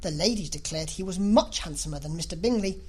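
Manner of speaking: ordinary delivery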